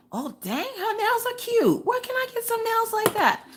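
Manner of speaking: very high, squeaky put-on voice; admiring viewer. Imitating a viewer admiring her nails, squeaky put-on voice. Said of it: "if you're looking at my nails going".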